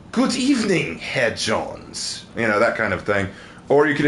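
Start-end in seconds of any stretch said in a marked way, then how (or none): 0.1-2.2 soft, lilting camp German accent. Shown as a demonstration. Demonstrating one of two German accents, camp German. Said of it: "the kind that sound incredibly homosexual".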